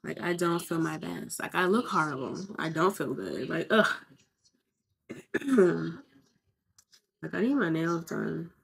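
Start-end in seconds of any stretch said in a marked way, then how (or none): none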